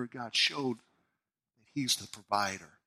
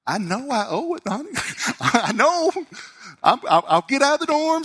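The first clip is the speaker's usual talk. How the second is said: higher, laughing, fast nervous promise; flustered promise; 9 semitones higher, 4 dB louder than usual. Retold or imitated. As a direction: laugh it off like you're caught owing money. Retold flustered reply about owed tuition.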